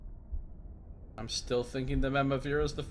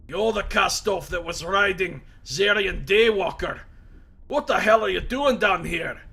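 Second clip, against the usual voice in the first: loud, raised voice of a wounded prisoner; gruff Mazov; 6 semitones higher, 8 dB louder than usual. Acted. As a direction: bark it at the intruder in surprise.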